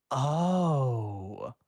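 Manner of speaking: the penny drops; teen catching on, a touch surprised; realizing. A narrator's reading. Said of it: "Danny realized".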